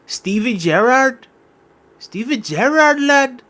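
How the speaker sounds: drawn-out, high-pitched Scouse impression; attempted Scouse accent. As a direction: stretch it into a questioning Scouse squeal. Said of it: "Am I doing this Scousers accent?".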